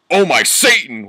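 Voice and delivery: high, loud hammed-up cry; mock scream. Lovers caught by teachers, hammed-up scream. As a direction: scream it in theatrical shock. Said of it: "we screamed".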